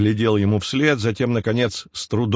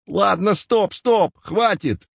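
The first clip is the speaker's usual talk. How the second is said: high, slow frantic yell; 10 semitones higher, slower than usual. A narrator's reading. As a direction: shout to make it stop. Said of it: "заорал Васечкин".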